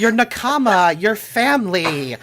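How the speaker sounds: high, loud sugary explanation; overly sweet. Acted. Watashi explains nakama to Scarlet, high and overly sweet. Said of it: "smiles just overly sweetly at you".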